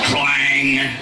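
one long, ringing clang; imitated metal clang. Comedian falls onto metal railing, imitated clang. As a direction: draw out the word like struck metal.

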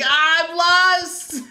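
high, slow, helpless little cry; lost duckling. Retold or imitated. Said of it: "I felt like a little duckling".

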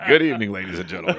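sudden announcer voice slipping out; radio announcer voice. Shown as a demonstration. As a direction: roll it out like a radio DJ. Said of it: "the voice just snuck out there".

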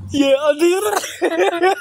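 slow, high words broken by giggles; laughing while talking. Real, not acted. Grandson answering Papa Greg while still giggling. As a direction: giggle your way through it.

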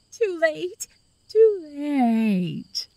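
high, drawn-out wail. Acted. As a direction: wail it like a lost ghost. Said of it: "she wailed in a changing voice".

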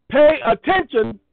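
loud, slow, drawn-out order; slowed commanding call; emphatic command. Retold or imitated. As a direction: demand their attention. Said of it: "You see how I said that three times already?".

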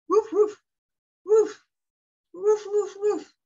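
high, loud woof-woofs; playful dog barking. Pretend. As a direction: bark like a doggy for a child. Imitating a dog for a child.

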